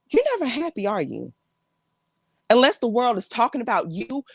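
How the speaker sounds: exasperated family confrontation with big swings in pitch; fed-up relative confronting drama-seeker. Acted. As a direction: scold an attention-seeking relative, pausing between jabs.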